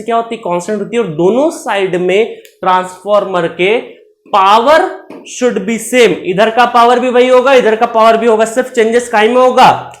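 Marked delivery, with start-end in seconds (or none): 4.3-6.1 very slow, word by word; slow dictating pace; slow dictation. Shown as a demonstration. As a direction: spell it out slowly for notes. Teacher dictating slowly.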